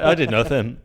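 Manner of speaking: gleeful mocking echo; laughing loud replay. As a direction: replay the clip line loudly while laughing. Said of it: "I was like".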